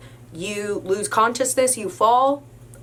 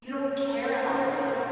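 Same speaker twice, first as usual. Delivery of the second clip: drugged customer; 5 semitones higher, 7 dB quieter than usual. Retold or imitated. Drugged man accusing the clerk, soft dazed mumble. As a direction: murmur it hurt and dazed. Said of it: "and he says".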